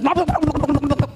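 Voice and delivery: imitated munching plops. Storyteller imitates Anansi attacking his breakfast, rapid plopping noises. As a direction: rattle out comic gobbling noises. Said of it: "And he goes".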